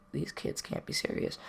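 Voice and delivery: hushed, breathy aside in disbelief; a stunned whisper under the breath. Retold or imitated. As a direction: whisper it like you can't believe them. Disbelief at kids telling teacher.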